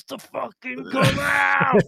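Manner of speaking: slow, rising, straining build; monster bursting out. Acted. Describing the boxer's inner monster, straining to burst out. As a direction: strain upward as if something is breaking loose.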